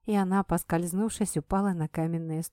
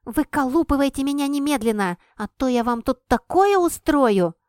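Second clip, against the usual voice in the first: loud angry threat; threatening; 6 semitones higher, 7 dB louder than usual. A narrator's reading. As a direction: yell a furious threat. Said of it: "и пригрозил".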